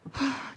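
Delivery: soft fed-up exhale; a hint of the daughter's sigh; imitated sigh. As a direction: sigh the child's wordless reproach. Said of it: "dia cuman gitu aja".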